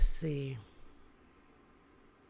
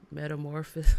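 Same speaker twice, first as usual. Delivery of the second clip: ordinary delivery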